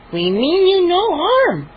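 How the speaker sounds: slow, high gnome voice. Acted. Gnome repeating the reassurance, slow and high. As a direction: repeat it patiently. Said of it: "Chip said once again".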